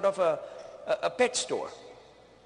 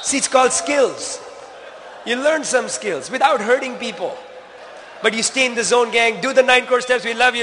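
preaching at full force; 4 semitones higher, 12 dB louder than usual. Acted. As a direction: drive the last lines home to the crowd. Closing rally to the team.

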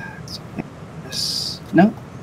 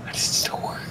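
whispered to himself; whispering; 8 dB quieter than usual. Real, not acted. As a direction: breathe it in quiet disbelief. Same question repeated to self.

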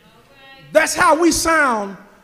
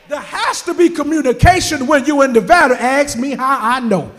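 loud, rising shouted preaching; preaching at full force; 8 dB louder than usual. Acted. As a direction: drive it home to the congregation.

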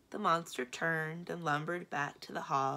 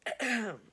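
short acted cough; polite throat-clearing; 6 semitones higher, 4 dB louder than usual. A narrator's reading. Secretary prepares a delicate suggestion, polite throat-clearing. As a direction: clear the throat before speaking up. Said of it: "Mister Ledbetter coughed".

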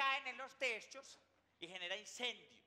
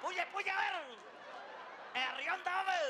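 rowdy cousin; 6 semitones higher than usual. Acted. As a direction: egg her on, climbing higher. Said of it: "Y aparece el primo".

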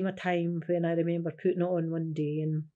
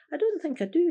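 playful pretending; 10 semitones higher, 6 dB louder, faster than usual. Feigning not knowing his wife.

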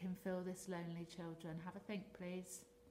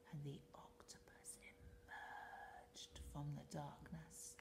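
whispering; 4 semitones lower, 10 dB quieter, slower than usual. A narrator's reading. Narration drops to whisper.

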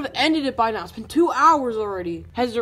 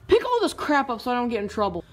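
ordinary talk, nothing marked

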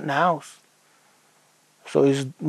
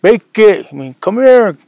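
raised voice calling across the forest; father shouting for his lost son; 6 semitones higher, 7 dB louder, slower than usual. Retold or imitated. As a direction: call the boy home from far off, higher and louder. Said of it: "He'd be calling his name".

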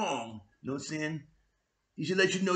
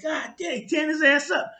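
excited reaction; 8 semitones higher, 4 dB louder than usual. Retold or imitated. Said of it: "I was like".

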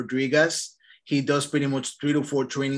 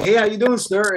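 raised, louder hello to a stranger; retold upbeat greeting; 5 semitones higher, 4 dB louder than usual. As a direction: call it out as a door-to-door opener. Retelling his door-knock greeting.

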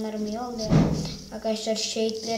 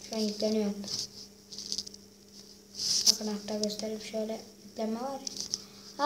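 ordinary delivery

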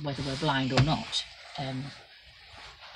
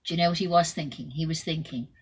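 ordinary delivery

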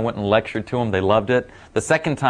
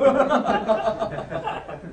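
real laugh; 12 semitones higher, 4 dB quieter than usual. Real, not acted. Laughs at own story.

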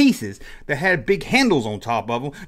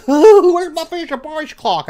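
put-on much higher, louder, slow excited child; eager kid; 12 semitones higher, 7 dB louder, slower than usual. Child answering his mom about school, higher and excited. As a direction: ask for the clock like an eager little kid.

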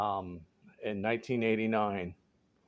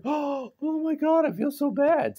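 mock guilty gasp; 13 semitones higher than usual. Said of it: "And they're like".

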